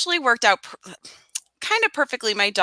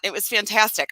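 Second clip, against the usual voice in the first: normal talking voice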